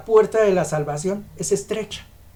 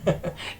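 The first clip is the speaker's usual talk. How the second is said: soft laugh; 7 dB quieter than usual. Real, not acted. Preacher laughs after quote.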